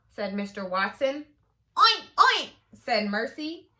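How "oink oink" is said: high, loud oink oink; a happy pig grunting. Acted. The pig Mercy speaks.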